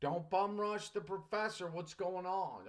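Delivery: a hint of playful alarm; retold self. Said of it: "I said".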